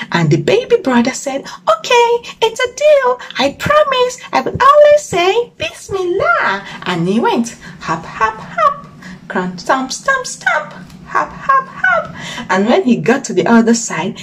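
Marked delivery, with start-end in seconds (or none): 1.7-6.6 very high cheerful frog voice; high baby frog. Acted. 7.8-12.2 squeaky high hops and stomps; high hopping noises. Acted. Hopping sound effects.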